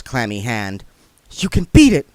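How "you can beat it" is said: loud, high urging; urgent. Acted. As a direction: press him to hold on. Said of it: "she said urgently".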